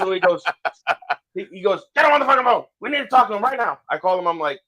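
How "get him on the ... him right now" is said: imitating a higher, pushy bark, rushed at the end; bossy higher-pitched command, sped up; mock angry order. Manager ordering the kid to phone his dad, mock bark.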